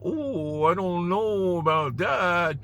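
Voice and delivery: drawn-out suspicious passenger voice; doubtful passenger. Retold or imitated. Rider eyeing the beater car, slow doubtful murmur.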